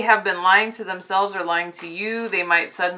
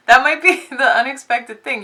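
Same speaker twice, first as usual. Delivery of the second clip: giggling through the line, a bit higher and louder; laughing; 4 semitones higher, 7 dB louder than usual. Real, not acted. Tarot reader laughing at a surprising card to viewers. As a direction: let the laugh break into the words. Laughing while reading the cards.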